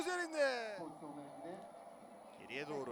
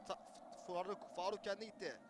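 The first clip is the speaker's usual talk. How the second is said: ordinary talk, nothing marked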